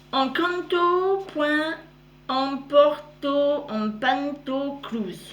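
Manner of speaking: "Encanto point Emporto Empanto Cruz" sounded out slowly, syllable by syllable, stumbling; fumbled foreign name. Real, not acted. Reading an Instagram name to viewers, slow and unsure. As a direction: grope through the foreign handle slowly, unsure of each sound. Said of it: "navré pour".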